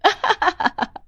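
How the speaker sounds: high, staccato scheming cackle; gloating laugh. Acted. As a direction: cackle secretly over your trick. Schemer gloats in her thoughts. (चालाकी भरी ऊँची हँसी)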